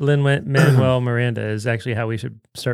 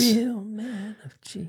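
high, slow, sung-out ad announcer; beer ad voice; 8 semitones higher, 7 dB quieter, slower than usual. Retold or imitated. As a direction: croon it like a beer commercial. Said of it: "It's a beer commercial".

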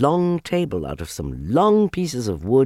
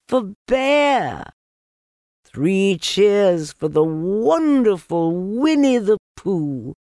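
slow, high, loud cheering; 11 semitones higher, 5 dB louder, slower than usual. Acted. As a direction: cheer it out slowly. Cheering chorus.

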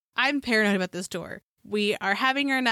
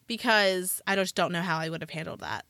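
ordinary talk, nothing marked

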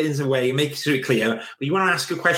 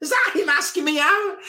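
much higher, wide-eyed surprised gasp; mock disbelief. Friend asks if a date was a date, mock amazed. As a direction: feign shock at the news, pitched way up. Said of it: "they're like".

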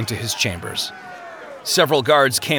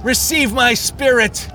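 high, drawn-out cry to heaven; impassioned high-pitched cry; 11 semitones higher than usual. A narrator's reading. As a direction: cry out in agony to the Lord. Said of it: "he cried out".

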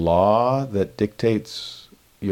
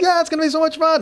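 very high, fast, gushing squeal; exaggerated thrill to keep a child's eyes on you; over-the-top excited; much faster than usual. Dad in his daughter's face as the man passes, frantically excited. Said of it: "it's just in her face".

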